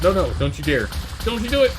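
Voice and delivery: frantic shout at the monster; panicked yelling. Real, not acted. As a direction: yell it in real panic. Panicking as enemies attack.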